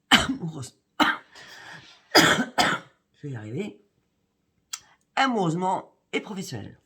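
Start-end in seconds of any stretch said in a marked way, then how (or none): 0.1-3.8 coughing through the words, slow and strained; fighting a cough to finish; swallowed the wrong way. Real, not acted. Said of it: "J'ai avalé de travers".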